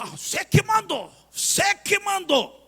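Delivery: bem mais agudo e alto, debochado; demônio zombando. Retold or imitated. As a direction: um demônio reclamando com deboche. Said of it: "o demônio voltou e falou". (demônio voltando para reclamar, agudo e zombeteiro)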